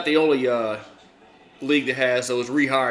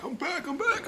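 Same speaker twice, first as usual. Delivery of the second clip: sing-song; 7 dB quieter than usual. Acted. Announcing return, playful.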